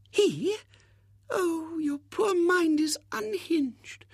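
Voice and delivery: high, slow, cooing lady's voice; soothing spinster. Acted. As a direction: soothe him like a fussing old maid. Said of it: "said Miss Rubina soothingly".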